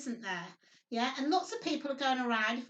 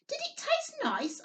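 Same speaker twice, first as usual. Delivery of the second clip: put-on child's voice; 13 semitones higher than usual. A narrator's reading. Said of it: "asked Topsy".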